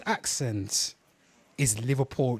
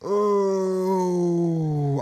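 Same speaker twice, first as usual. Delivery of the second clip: drawn-out, high, loud "oh"; high wailing "oh"; long dismayed groan; 6 semitones higher, 6 dB louder than usual. Real, not acted. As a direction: let out a long, loud "oooh" of dread. Bracing for the video.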